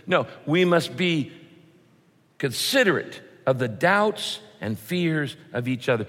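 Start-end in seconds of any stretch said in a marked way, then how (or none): none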